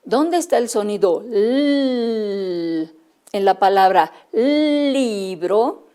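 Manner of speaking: slow, word by word, with libro stretched; slow teaching voice. Shown as a demonstration. Teacher asking children where the sound l is, slowly. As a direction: spell it out slowly for little ones. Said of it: "si yo digo".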